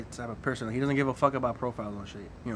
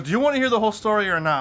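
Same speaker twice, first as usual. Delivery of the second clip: higher, louder and fast, fed up at being interrupted; exasperated demand; 7 semitones higher, 7 dB louder, faster than usual. Retold or imitated. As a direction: rattle it off, annoyed and loud. Friend demanding to be heard.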